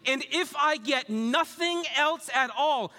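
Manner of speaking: high, emphatic declaration to God; voicing the psalmist with full conviction; psalmist's declaration. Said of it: "This voice here is that good. He's saying".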